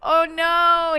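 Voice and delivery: high, loud wail of dismay; dismayed cry. Real, not acted. As he turns against her, a high loud dismayed cry. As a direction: cry out as it goes wrong. Edward rejects [name].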